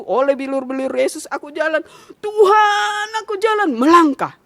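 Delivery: klaim iman lantang, makin memuncak jadi teriakan; seruan doa yang menggebu. Retold or imitated. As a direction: berseru kepada Tuhan, makin tinggi dan keras. Memperagakan doa imannya, memuncak. (doa berapi-api)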